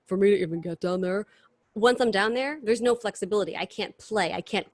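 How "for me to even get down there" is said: a hint of a deep singer's voice. Shown as a demonstration. Explaining the hard impression, lightly deepened star voice. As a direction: drop slightly into a deep impression voice. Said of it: "because her voice is so deep".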